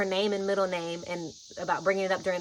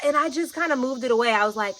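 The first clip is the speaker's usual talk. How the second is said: ordinary delivery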